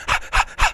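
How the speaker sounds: quick voiceless pants, imitating a hungry zombie; imitated dog-like panting. Showing how the kids go when the change hits, dog-like panting. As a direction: pant like a dog, all breath. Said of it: "they're like panting like dogs almost".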